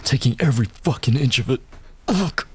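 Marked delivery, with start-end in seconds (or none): 2.1-2.5 high, strained moan on one word; a gasped moan, climbing in pitch; pleasured moan. Acted. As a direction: let it slip out as a groan of pleasure. Aroused moan mid-scene.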